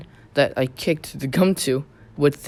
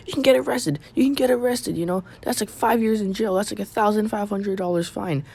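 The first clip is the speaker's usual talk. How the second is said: mock screaming; 6 semitones higher than usual. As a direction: scold in a raised, high voice. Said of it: "'Cause they started screaming".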